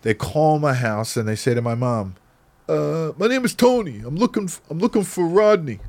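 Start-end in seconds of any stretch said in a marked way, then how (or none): 2.7-5.8 put-on higher-pitched impersonation of an older shopkeeper; put-on old man's phone voice; old man caller. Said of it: "You sound like an old man".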